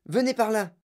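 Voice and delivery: high, eager summons; excited call to follow; urgent calling. A narrator's reading. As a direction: hurry them along, pitch up. Urging everyone across the room.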